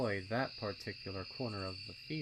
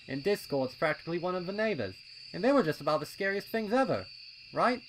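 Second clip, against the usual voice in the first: high, loud, impatient colt voice; a keen little colt itching to go; eager young colt; 7 semitones higher, 9 dB louder than usual. A narrator's reading. Pipsqueak urging his friends at the trail, high and loud.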